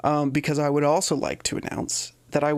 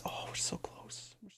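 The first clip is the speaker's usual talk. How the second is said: excited whisper; hushed and eager; 18 dB quieter than usual. Real, not acted. Whispering excitedly before release.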